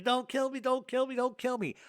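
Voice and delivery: high pleading repeated Don't kill me; mock panicked pleading. Friend in the car begging not to be killed, high mock panic. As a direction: beg for your life in a half-joking retelling. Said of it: "he starts screaming".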